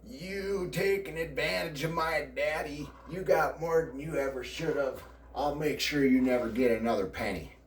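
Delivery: high, angry aunt accusing him; mock furious aunt; aunt's accusation. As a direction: voice the aunt scolding him, pitched well up. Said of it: "My aunt started".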